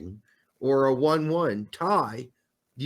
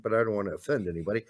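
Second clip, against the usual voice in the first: ordinary delivery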